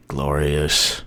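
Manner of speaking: low, soft "glorious"; hushed relish. Acted. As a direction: let "glorious" sink deep and quiet. Same word said low, then high.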